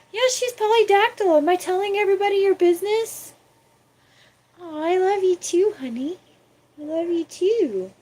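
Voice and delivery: high cooing voice for the cat. Real, not acted. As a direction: gush over the kitty.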